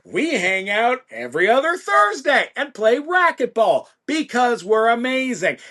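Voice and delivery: high, self-important producer bragging; Gustavo. Acted. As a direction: brag grandly with swooping, emphatic pitch. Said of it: "record producer with a giant ego".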